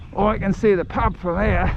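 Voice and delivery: put-on self-declared Aussie accent, lightly done. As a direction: redo the line in a mild Aussie accent. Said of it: "That's my Aussie accent".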